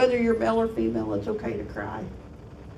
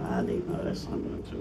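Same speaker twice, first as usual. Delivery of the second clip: put-on whispered, much lower mumble of a downcast boy; mumbling student; 5 dB quieter than usual. Student mumbling to the teacher, whispered and low. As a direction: mutter it low with eyes down. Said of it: "he had tears in his eyes".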